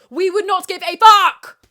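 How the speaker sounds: loud, high furious yell; all-out shouted punchline; full yell. Real, not acted. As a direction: scream it as the climax. Final repeat yelled.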